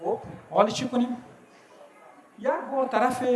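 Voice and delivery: ordinary talk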